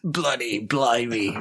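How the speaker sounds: hammed-up bad English accent; mock English accent. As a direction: put on a jokey English accent. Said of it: "you went from Australian to English".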